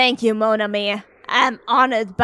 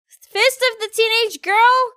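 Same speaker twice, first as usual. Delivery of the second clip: put-on excitable high girl; 11 semitones higher than usual. Schoolgirl picking her silly attack, high and eager. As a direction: pitch it way up like a hyper schoolgirl.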